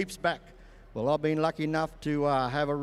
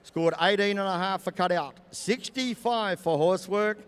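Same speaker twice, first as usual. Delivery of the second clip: arena announcer; 4 semitones higher, 4 dB louder than usual. Acted.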